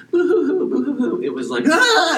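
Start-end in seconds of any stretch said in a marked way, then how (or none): 0.1-1.2 very high-pitched fake sobs; pretend-cry in a high, silly boo-hoo; mock boo-hoo crying. Preacher showing what the cry wasn't like, mock high boo-hooing. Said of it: "I'm sure it wasn't like". 1.7-2.2 sudden high, loud shriek; imitated scream. Shown as a demonstration. Preacher waking everyone up with the madman's cry, sudden high scream. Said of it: "it was like that kind of thing".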